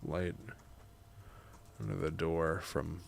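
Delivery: measured, soft reading with long pauses; memoir narration. A narrator's reading. Writer narrating grandma's visit, measured memoir reading. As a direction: read it quietly, phrase by phrase.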